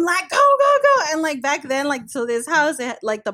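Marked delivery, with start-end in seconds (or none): 0.3-1.1 very high, loud urgent yell; retold yelling. Imitating David yelling at his friend to drive, urgent. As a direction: re-enact frantic shouting to drive off. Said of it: "like, yelling at him".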